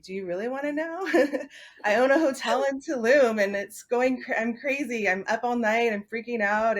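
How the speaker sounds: mock-frantic retelling, voice lifted; retold frazzled venting. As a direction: vent half-laughing about being swamped. Retelling her frazzled venting to friend.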